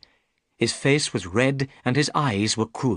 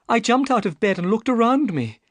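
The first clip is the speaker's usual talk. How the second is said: put-on young woman's voice, raised higher; a worried young lady pleading her case; Miss Stoner; 13 semitones higher, 4 dB louder than usual. Miss Stoner tells Holmes her trouble, higher anxious young woman.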